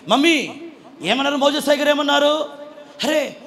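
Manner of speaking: put-on high, loud, upset girl pleading with her mother; daughter's loud protest. Daughter to her mother, refusing the marriage: high loud upset plea. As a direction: cry out like a frightened daughter refusing the match.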